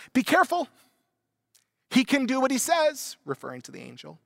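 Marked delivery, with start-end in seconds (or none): 0.1-3.2 much higher, louder little lizard voice; piping lizard. Acted. Lizard warning the ghost of a man, high piping voice. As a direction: pipe up like a sly tiny lizard. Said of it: "the fleshly little lizard pipes up".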